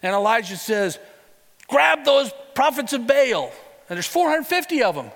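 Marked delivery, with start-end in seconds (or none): 1.7-3.5 loud, higher-pitched command; commanding order. Acted. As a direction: bark it at the crowd. Elijah commands the crowd.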